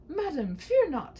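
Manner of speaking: high, slow chivalrous knight voice; a bold knight calming a frightened maiden; gallant knight. Acted. Knight reassuring the maiden, high and grand.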